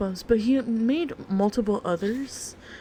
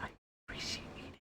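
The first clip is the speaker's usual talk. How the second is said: fading whisper; 15 dB quieter, much faster than usual. Acted.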